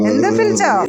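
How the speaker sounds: sharp loud repeat of ఎందుకు పిలిచావు, stress on పిలిచావు; loud call. Acted. Owner to her pet, a loud demanding call. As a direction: bark it out at the pet. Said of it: "repeated after plain take".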